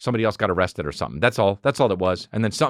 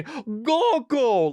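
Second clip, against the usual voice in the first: high-pitched mock wail; screaming, crying toddler; 13 semitones higher than usual. Toddler pleads to dad, mock screaming and crying.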